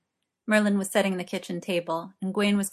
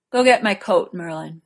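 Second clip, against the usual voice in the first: loud tipsy command; 7 dB louder than usual. Acted. Tipsy Arthur giving in.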